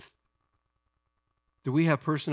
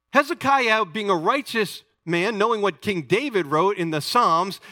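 rising, louder exhortation to the church; full-force preaching; 5 semitones higher, 5 dB louder than usual. Acted. Preacher urging his congregation to live the truth, full-force preaching. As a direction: preach it hard, pushing the congregation to act.

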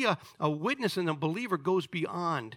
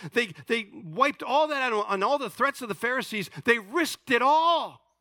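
higher, driving sermon voice building to a loud peak; impassioned preaching; 6 semitones higher, 3 dB louder than usual. Acted. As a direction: preach with mounting force, punching the key words. Preaching at rising force.

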